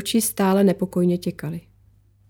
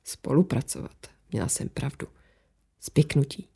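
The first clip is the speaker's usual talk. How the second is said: low, soft, near-whispered echo; hushed inner thought; 5 semitones lower, 7 dB quieter than usual. A narrator's reading. Laura echoes Alžběta's word to herself, hushed suspicion. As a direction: think it aloud under the breath.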